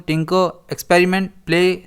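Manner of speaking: ordinary delivery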